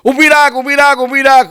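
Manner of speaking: high, urgent mock yell to stop; insistent high-pitched calling out; frantic yelling. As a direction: imitate a passenger screaming to pull over. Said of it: "બૂમાબૂમ શરૂ કરી".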